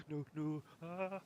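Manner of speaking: high, mildly panicked squeal; imitated panic. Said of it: "I panic".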